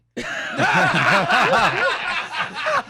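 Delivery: loud high burst of laughter; big laugh. Real, not acted. Son bursting out laughing at his father's story. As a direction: crack up at the punchline.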